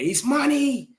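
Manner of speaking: high-pitched parody of complaining supporters; mocking rival fans. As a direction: chant the complaint in a silly high voice. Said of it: "when Newcastle fans are coming out there".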